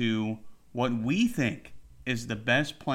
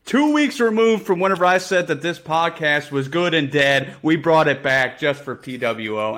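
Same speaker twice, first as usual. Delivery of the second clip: loud, upbeat show opener; podcast host intro; 3 semitones higher, 6 dB louder than usual. Real, not acted. Host welcoming listeners back, loud upbeat opener. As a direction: hype up the listeners.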